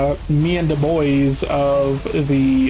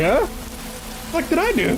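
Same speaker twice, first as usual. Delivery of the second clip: squeaky high, baffled huh what; shocked disbelief; 13 semitones higher, 3 dB quieter than usual. Retold or imitated. Accused at the bar, he squeaks back in shocked disbelief. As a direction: voice jumps way up in disbelief. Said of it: "And I was like".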